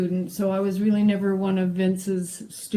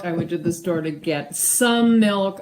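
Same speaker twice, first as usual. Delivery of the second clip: "some" held and weighted above the rest; stress on SOME; 3 dB louder than usual. Shown as a demonstration. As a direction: dwell on "some". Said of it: "how emphasis on certain words changes".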